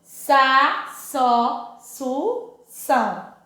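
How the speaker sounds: sa, só, su, são each said apart, slowly; careful teacher syllable chant; syllable drill. Shown as a demonstration. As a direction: model the ç syllables for children. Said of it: "Então, olha".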